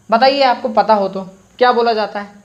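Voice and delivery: ordinary talk, nothing marked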